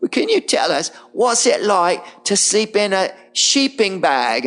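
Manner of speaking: put-on high-pitched little kid voice; cute little kid. Kid asks the TV star at a big crowd, cutely childlike. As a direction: put on a small child's halting, lisping question. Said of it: "cute little kid gets up".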